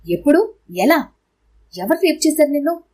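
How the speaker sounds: stunned husband firing questions; shocked. Acted. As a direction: blurt them out in disbelief. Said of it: "అతను షాక్లోకి పోయాడు".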